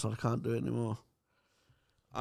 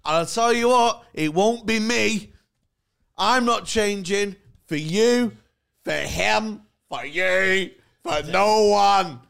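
mock defiance; 7 semitones higher, 9 dB louder than usual. Mock defiant vow to his mates.

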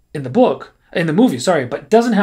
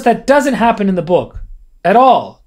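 ordinary talk

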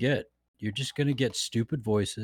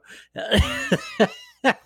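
loud high laugh; 4 dB louder than usual. Real, not acted. Laughing at the joke.

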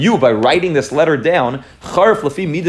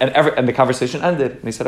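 ordinary delivery

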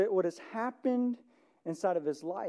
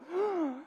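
shocked gasp; 10 semitones higher, 3 dB quieter than usual. Acted. Pharisees react in shock.

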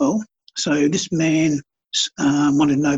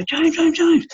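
excited calling out; 13 semitones higher, slower than usual. Retold or imitated. Bloke calls out in market.

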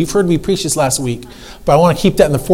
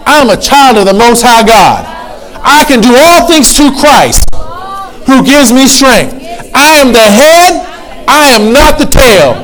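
builds from firm to a high shouted proclamation, slow; rising declaration shout; 7 semitones higher, 5 dB louder, slower than usual. Shown as a demonstration. Preacher leading the church's confession, building to a shout. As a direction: proclaim it louder and higher as you go. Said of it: "Repeat this to me out loud".

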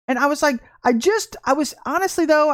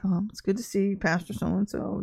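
sheepish mumble; 4 semitones lower, 8 dB quieter than usual. Retold or imitated. She answers the apostle meekly, caught out and sheepish. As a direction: shrink back as you greet him.

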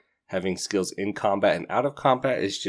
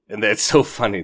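laughing; 2 semitones higher, 7 dB louder than usual. Real, not acted. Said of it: "I'm laughing too much".

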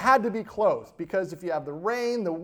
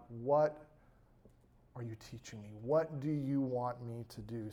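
soft humble prayer; 6 semitones lower, 11 dB quieter than usual. Acted.

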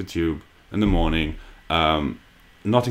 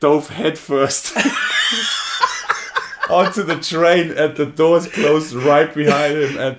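laughing; 7 semitones higher, slower than usual. Real, not acted. Excited retelling of man falling.

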